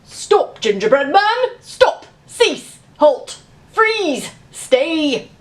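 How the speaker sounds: loud barked commands; shouting thesaurus. Acted. Thesaurus shouts at the gingerbread man to stop, loud. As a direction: order him to halt, one word after another. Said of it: "someone shouted".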